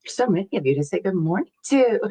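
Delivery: ordinary talk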